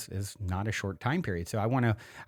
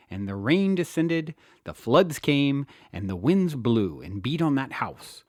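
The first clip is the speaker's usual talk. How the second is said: louder, solemn declamation of a Bible passage; scripture reading; 2 semitones higher, 4 dB louder than usual. Acted. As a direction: proclaim it like a preacher, then let the last lines sink. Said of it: "As Jesus said".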